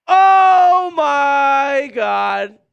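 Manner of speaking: slow, drawn-out stunned yell; shocked shout. Real, not acted. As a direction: let it burst out in disbelief. Shocked by super chat on stream.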